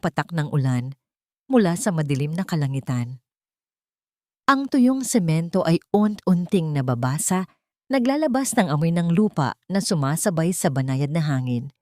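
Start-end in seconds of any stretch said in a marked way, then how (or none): none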